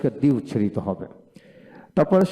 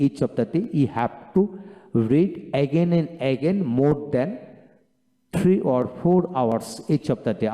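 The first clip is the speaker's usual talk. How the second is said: very slow, word by word English; much slower than usual. Shown as a demonstration. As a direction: drag each English word out slowly.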